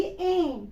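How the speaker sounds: "ए" sent through the nose; nasalised vowel. Shown as a demonstration. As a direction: hum the end of "ए" in the nose, no hard n. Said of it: "नून गुन्ना की आवाज नाक में जाएगी".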